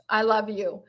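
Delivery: normal talking voice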